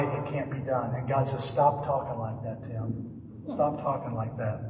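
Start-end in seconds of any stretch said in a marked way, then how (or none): 1.4-4.6 low, soft voice of God; hushed, lower, acted divine reproof; quiet rebuke. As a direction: correct him quietly and firmly. Voicing God's gentle rebuke to him.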